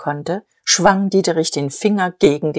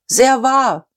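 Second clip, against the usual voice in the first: high, sustained call of assent; high heckle of approval; crowd interjection; 7 semitones higher, 3 dB louder than usual. Acted. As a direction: call out agreement from the audience. Audience interjection during the speech.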